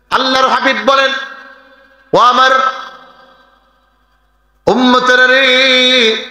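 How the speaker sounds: high, half-sung sermon chant; chanted pulpit exhortation; melodic preaching. Real, not acted. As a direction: intone it high, holding the phrase endings.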